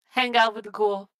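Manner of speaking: ordinary talk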